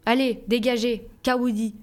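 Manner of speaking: a man silencing the family; forceful man's voice. A narrator's reading. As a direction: shut the others up. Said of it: "Une voix d'homme plus forte".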